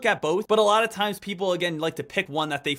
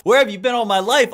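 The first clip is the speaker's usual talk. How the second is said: higher and much louder repeat of the plea; louder mock plea; 4 semitones higher, 8 dB louder than usual. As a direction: push the same line up, hammed-up. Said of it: "pretend viewer addressing Deck".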